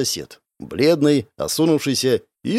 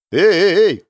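higher, louder protest; indignant; 6 semitones higher, 6 dB louder, slower than usual. A narrator's reading. Narrator protesting at his friends' warmth, indignant. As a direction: cut in loudly with indignation. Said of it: "возмутился я".

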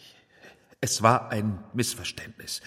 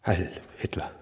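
barely voiced, forced Heil Hitler; reluctant whisper; 4 semitones lower, 6 dB quieter, faster than usual. Director forced to return the salute, reluctant whisper. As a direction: say it under your breath, unwilling.

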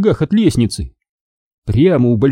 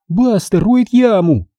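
put-on high, stretched girl's alarm; horrified cry; 9 semitones higher, slower than usual. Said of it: "в ужасе вскрикнула она". (вскрик ужаса)

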